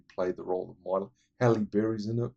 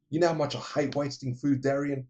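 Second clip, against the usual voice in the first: mock stern father; 6 semitones higher, 3 dB louder than usual. Father scolding daughter in retold scene.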